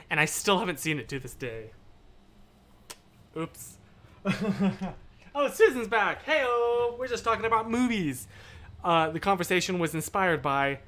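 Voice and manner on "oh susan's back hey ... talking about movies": high, singsong welcome with a laugh; delighted greeting. Real, not acted. Streamer greeting returning viewer, delighted. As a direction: welcome a friend back, pitched high.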